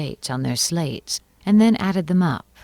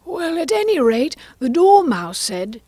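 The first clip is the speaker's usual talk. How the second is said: Hatter; 10 semitones higher, 7 dB louder than usual. Acted. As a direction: press on in a pitched-up, nervous voice. Hatter continues his testimony.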